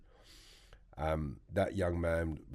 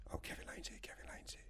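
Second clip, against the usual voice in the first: hushed whisper passing the news; whispering inmates spreading word he's arrived; whispered gossip; 16 dB quieter than usual. Retold or imitated. Prisoners whispering about his arrival.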